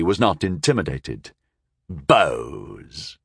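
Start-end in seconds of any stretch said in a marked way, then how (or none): 1.9-3.2 low, falling, scornful echo; dismissive sneer. A narrator's reading. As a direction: sneer the word, pitched down. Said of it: "he sneered dismissively".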